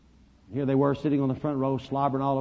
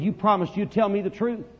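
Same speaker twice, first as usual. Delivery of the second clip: higher-pitched insistent plea; raised, insistent reminder; pleading child; 7 semitones higher, 3 dB louder than usual. Retold or imitated. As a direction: press him like a hurt little boy. Boy pleading with the preacher.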